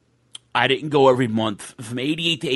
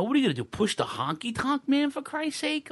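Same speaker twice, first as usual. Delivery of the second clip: incredulous rant; 9 semitones higher, 4 dB quieter than usual. Real, not acted. Ranting at bookers, incredulous.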